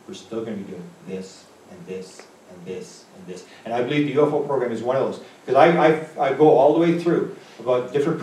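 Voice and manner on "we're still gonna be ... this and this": ominous hush. Retold or imitated. Spy chief's ominous warning.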